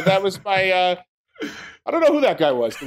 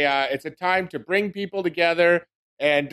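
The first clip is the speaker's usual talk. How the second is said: normal talking voice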